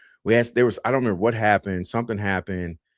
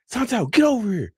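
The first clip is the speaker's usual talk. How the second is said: high-pitched mock drill sergeant call; drill sergeant's summons; 12 semitones higher than usual. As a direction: call him over sharply, without extra volume.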